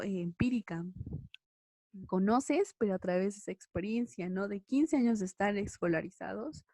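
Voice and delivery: ordinary talk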